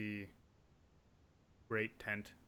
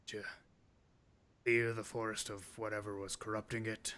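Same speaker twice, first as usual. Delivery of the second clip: a louder, blustering orc chief; the orc chieftain questioning the hero, louder; 5 dB louder than usual. Acted. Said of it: "The orc looks down upon you and says".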